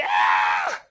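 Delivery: low mock baby wail; short imitated baby cry. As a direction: wail like a newborn. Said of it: "The baby".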